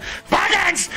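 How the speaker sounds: exasperated outburst. Acted. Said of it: "He's like".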